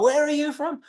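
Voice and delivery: high-pitched, mocking disbelief; mock incredulous. Viewers reacting to a wrong accent, mock incredulous. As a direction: question it as if the accent is baffling. Imagined critics of a bad accent.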